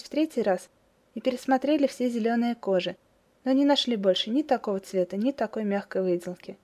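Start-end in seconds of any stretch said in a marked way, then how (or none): none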